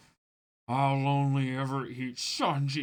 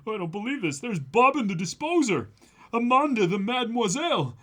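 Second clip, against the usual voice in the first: excited character read; 10 semitones higher, 6 dB louder than usual. Acted. Reading manga, excited character line.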